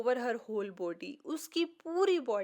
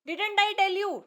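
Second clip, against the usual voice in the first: higher, brighter, told-you-so delight; 7 semitones higher, 4 dB louder, much slower than usual. Acted. Teacher delighted the shy girl finally spoke, bright and higher. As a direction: share her triumph with her.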